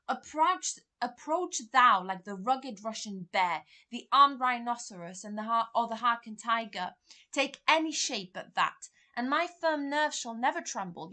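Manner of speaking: shouting defiance at the ghost; defiant challenge. Acted. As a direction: dare the ghost to come at him.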